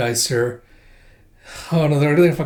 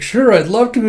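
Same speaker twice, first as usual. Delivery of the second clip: high, louder, keen acceptance; eager yes; 8 semitones higher, 5 dB louder than usual. Retold or imitated. Boy accepting tea cakes, high and eager. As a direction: jump at the offer. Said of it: "And he's like".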